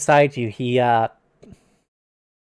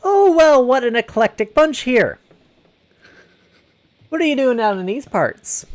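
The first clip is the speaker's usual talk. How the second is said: higher-pitched greeting from a passing traveller; nosy wagon driver; 9 semitones higher than usual. Acted. Wagon driver sizing up an odd group on the road, nosy high greeting. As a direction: exclaim it high, easing down into the question.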